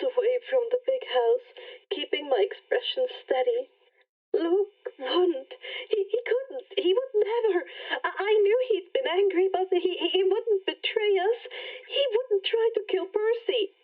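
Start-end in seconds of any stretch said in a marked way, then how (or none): 4.3-13.8 distraught denial. Acted. Annabeth denies Luke's betrayal, distraught.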